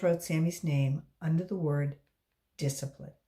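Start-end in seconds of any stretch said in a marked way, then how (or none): none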